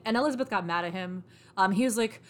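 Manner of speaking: ordinary delivery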